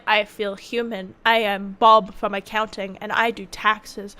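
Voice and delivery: lightly voiced monotone office worker; flat office drone. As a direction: play Bob from accounting, utterly bland. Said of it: "I'm like".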